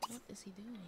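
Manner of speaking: hushed unvoiced whisper to herself. Real, not acted. A player whispering at her Sim on screen. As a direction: breathe the question out, no voice.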